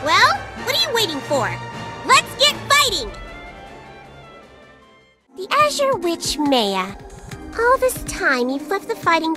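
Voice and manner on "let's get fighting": high-pitched, lively urging; rally them into the fight, pitched high; eager rallying cry. Acted. Fairy sending the witch into battle, eager rallying cry.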